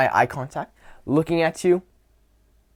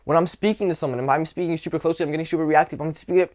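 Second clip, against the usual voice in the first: rushed, reactive chatter; super fast; faster than usual. Shown as a demonstration. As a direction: rattle it off without pauses. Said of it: "than me speaking super fast".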